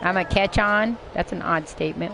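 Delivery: ordinary talk, nothing marked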